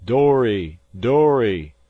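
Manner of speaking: loud calling cry from far off; muffled cry. A narrator's reading. The cook calling the lost boys, loud muffled cry. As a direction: call through thick fog to the boys. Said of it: "a muffled voice cried through the fog".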